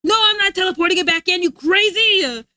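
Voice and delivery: wife shouting back at husband; loud refusal. Real, not acted. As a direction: push back at him loudly.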